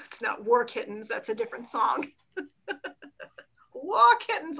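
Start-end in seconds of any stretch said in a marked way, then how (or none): 3.7-4.6 high playful. Acted.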